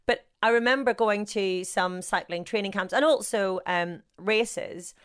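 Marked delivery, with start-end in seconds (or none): none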